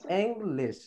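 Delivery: ইংলিশ drawn out as "eng-lesh", falling; actual pronunciation. Shown as a demonstration. Teacher repeats it for guardians, careful "eng-lesh". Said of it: "actual প্রনাউন্সিয়েশনটা হলো".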